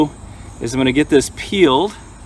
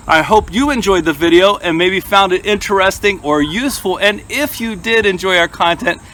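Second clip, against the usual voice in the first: vlog outro; 4 semitones higher than usual. Acted. Channel outro sign-off.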